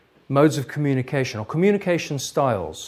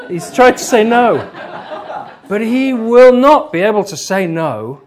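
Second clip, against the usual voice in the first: laughing; 6 semitones higher, 7 dB louder than usual. Real, not acted. Teacher laughing while explaining.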